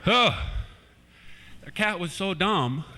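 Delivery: normal talking voice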